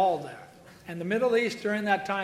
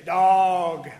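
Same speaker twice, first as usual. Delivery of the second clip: loud drawn-out Alabama dawg; Alabama accent; 2 semitones lower, 7 dB louder than usual. Retold or imitated. Preacher imitating an Alabama local saying dawg, loud Southern. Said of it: "I heard somebody say".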